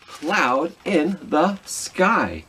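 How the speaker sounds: slow reveal. Acted. Story ending, slow reveal.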